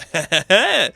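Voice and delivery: short, high, loud chuckle; amused laugh. Real, not acted. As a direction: laugh at the flirty moment. Laughs at the flirty scene.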